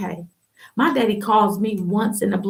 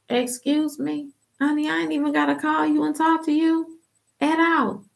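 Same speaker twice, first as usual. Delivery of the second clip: sassy retort; 7 semitones higher than usual. Retold or imitated. Telling the stepmom off, high and sassy. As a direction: brush her off with high sass.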